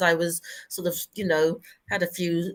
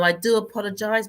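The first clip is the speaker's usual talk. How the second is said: ordinary talk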